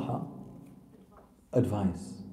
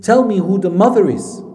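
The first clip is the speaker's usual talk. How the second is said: loud, higher-pitched urgent demand; intense demand; 3 semitones higher, 8 dB louder than usual. Retold or imitated. Mother demanding answers from her crying son, intense. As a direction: retell it forcefully, voice raised. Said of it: "It was so intense".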